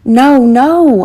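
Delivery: pleading as they are dragged; begging. A narrator's reading. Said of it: "I begged".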